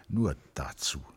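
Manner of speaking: hushed unvoiced realisation; whispered aside. A narrator's reading. Narrator whispering Christine's bitter thought. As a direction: drop to a bare whisper.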